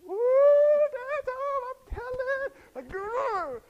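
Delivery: very high sing-song tattling; singsong it to tease; little sister gloating that dad's home; taunting little sister. Retold or imitated.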